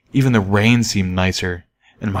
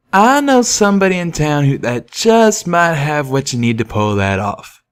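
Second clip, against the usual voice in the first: raised, friendly cowpony twang; Calamity's voice; 6 semitones higher than usual. Acted. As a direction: speak as the eager young pegasus. Calamity speaks.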